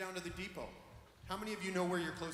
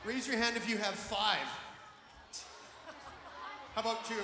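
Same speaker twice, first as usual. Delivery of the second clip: calling out; 4 semitones higher than usual. Real, not acted. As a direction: raise the voice so the whole hall hears. Calling out to the crowd.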